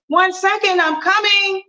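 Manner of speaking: high sing-song call toward the door; calling mom. Acted. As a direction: call out brightly.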